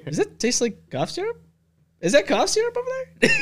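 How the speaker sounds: high laughing tease. Real, not acted. Teasing a friend, laughing.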